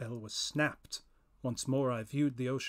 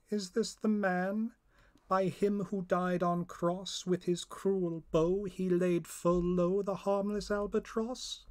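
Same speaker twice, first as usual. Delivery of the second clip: higher, otherworldly accusing spirit; eerie raised voice of a judging spirit; first spirit voice; 6 semitones higher, 5 dB louder than usual. Acted. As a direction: voice a stern spirit naming the killer. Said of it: "two voices in the air".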